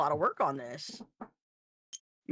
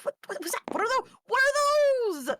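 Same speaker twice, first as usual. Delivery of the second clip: astonished; 13 semitones higher than usual. Acted. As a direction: gasp it out in disbelief. Character astonished at artifacts.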